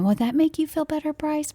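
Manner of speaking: put-on high, sweet-talking kid voice; high, coaxing little-boy question; imitating eager child. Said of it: "I wanna be able to make him feel better".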